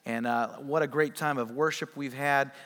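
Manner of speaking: soft, quiet prayer voice; hushed pastor's prayer, soft and reverent. Acted. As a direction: pray gently to the Lord, hushed. Leads a prayer.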